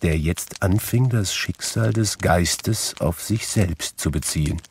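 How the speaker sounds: ordinary delivery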